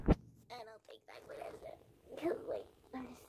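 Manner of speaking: whispered aside. Real, not acted. Unvoiced aside.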